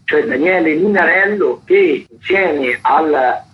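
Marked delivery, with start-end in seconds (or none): none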